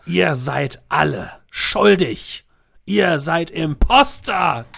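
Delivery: slow dramatic accusation. Acted. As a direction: pronounce the verdict slowly.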